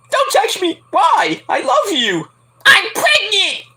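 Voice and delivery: high falsetto soap-opera wail; mock dramatic Carmella. Host mimics Carmella announcing pregnancy in a high falsetto. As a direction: overplay a tearful diva reveal. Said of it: "Corey's like".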